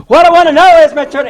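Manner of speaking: very loud, high-pitched shout; retold yell. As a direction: yell to get through to someone nearly deaf. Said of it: "then I just yell it".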